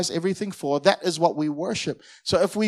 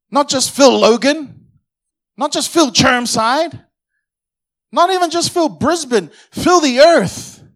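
fiery preaching; 6 dB louder than usual. Acted. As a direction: build the crowd up, hammer each place. Preaching at full force.

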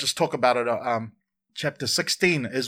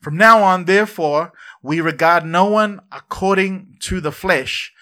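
loud congregational recital; 5 semitones higher, 7 dB louder than usual. Shown as a demonstration. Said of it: "Everybody say".

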